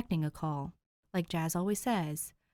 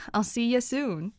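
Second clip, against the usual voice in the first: city drawl; 5 semitones higher, 6 dB louder than usual. A narrator's reading. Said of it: "in his city drawl".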